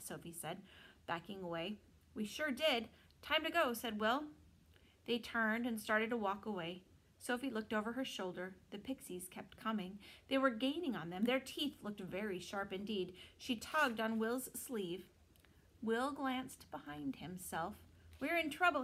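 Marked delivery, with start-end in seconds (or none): 2.1-3.7 louder, higher hurried urgency; urgent. Acted. Pixies charging. 18.2-18.8 high, loud sudden alarm. Acted. Pixies gaining on them.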